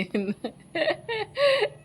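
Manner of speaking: a high, loud laugh; burst of laughter. Real, not acted. Vlogger laughing at the memory of the relative's visit, loud laugh. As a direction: crack up out loud.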